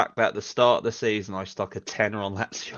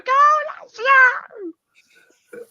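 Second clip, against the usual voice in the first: high, loud excited shout; kid cheering; 9 dB louder, much slower than usual. Retold or imitated. Boy at home cheering Lazio on TV, shrill shout. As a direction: yell it like a little kid cheering on the telly. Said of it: "I was just running around going".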